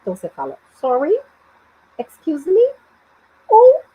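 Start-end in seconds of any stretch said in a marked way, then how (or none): 0.7-2.7 "Sorry" and "excuse me" each rising; question tone. Shown as a demonstration. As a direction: ask as if you didn't understand. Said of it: "quando você não entendeu muito bem".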